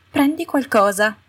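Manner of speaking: high, sharp little command; ordering Umbridge. A narrator's reading. Umbridge ordering Mafalda to grab a weapon, high and curt. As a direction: order her to take one. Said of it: "le ordinò".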